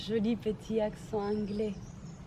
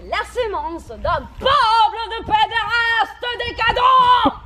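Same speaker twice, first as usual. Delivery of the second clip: slow, climbing to a very loud high yell; building rant; 10 semitones higher, 12 dB louder, slower than usual. Acted. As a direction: build the insult to a scream. Rising rant.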